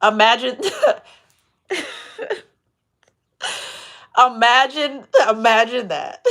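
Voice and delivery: laughing through the word; cracking up, barely getting it out. Real, not acted. Reader laughing at the betrayer's foolishness. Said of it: "that just cracked me up".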